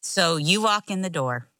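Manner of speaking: ordinary talk, nothing marked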